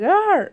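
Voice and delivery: high loud ah; short fighting shout; battle cry. Retold or imitated. Reviewer imitating Zero's attack, loud high ah. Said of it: "he goes".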